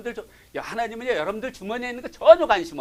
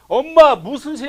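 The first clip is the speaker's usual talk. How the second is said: louder, higher, slow defiant retort; mock teenage backtalk, louder and higher; defiant child; 8 semitones higher, 11 dB louder, slower than usual. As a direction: talk back to mom like a sulky teen. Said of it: "싸우려고 대들었을 때".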